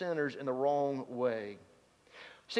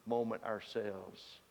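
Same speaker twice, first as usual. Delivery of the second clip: ordinary talk, nothing marked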